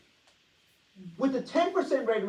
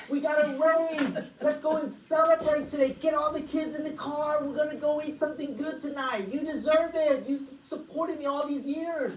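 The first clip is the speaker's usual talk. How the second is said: excited husband; 6 semitones higher than usual. Retold or imitated. Husband calls wife about a raise, giddy and thrilled. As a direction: gush about the raise like it's huge news. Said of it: "you call your wife".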